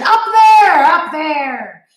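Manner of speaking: sharp, loud, high snap; loud irritated snap; snapped. A narrator's reading. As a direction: bark it out impatiently. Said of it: "he snapped".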